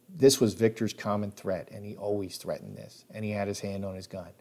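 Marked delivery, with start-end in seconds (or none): none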